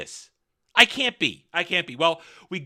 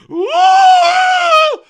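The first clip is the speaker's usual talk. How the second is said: long, very high, full-volume wail; mock summoning shriek; 13 dB louder than usual. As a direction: hold a piercing note, mocking her call. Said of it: "She goes".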